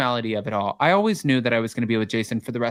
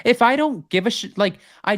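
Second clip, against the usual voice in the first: raised, high protest; indignant and louder, going higher; 6 semitones higher, 4 dB louder than usual. Real, not acted. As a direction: push back loudly, defensive. Defending relationship, raised voice.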